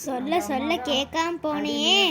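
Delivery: slow, low, drawn-out lament; sorrowful reproach. Acted. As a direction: reproach her sorrowfully, taking your time. Mother laments over daughter's ignored advice. (மெதுவாக வருத்தத்தோடு குறைபடுவது)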